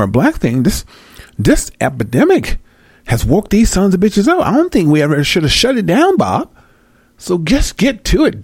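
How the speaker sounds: high-pitched caricature of a politician complaining; mock official rant. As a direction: rant like a rattled bigwig.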